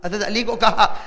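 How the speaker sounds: usual speaking voice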